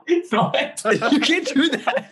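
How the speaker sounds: high squeal of laughter; words squeezed out through laughing. Real, not acted. Friends laughing at rude story.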